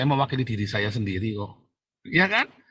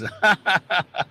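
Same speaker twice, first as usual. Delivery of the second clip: higher, staccato cackling laugh; gloating laugh; 7 semitones higher than usual. Real, not acted. Preacher mocking a jailed rival's tears, gloating laugh. Mocks rival crying in prison.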